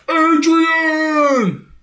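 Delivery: a friend hamming up a famous yell; mock Rocky yell. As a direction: bellow it like the movie boxer. Yelling like Rocky.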